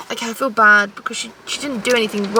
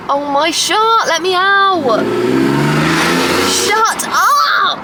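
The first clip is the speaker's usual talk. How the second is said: comic rant; 6 semitones higher, slower than usual. Real, not acted. Ranting at a photo-taking crowd.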